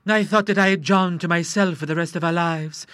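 a touch of sobbing in the voice; tearful wife. Acted. Wife lamenting her husband going to sea, mildly tearful. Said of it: "sobbed Missus Hay Denver".

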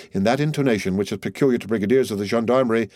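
plain reading voice

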